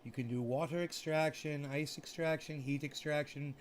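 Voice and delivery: ordinary talk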